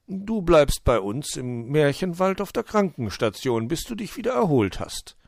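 gehobene, beruhigende Uhustimme; erklär es freundlich als Uhu, etwas höher; fürsorglicher Uhu. Acted. Uhu offers the second option. (fürsorglich erklärender Uhu)

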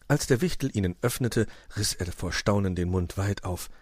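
usual speaking voice

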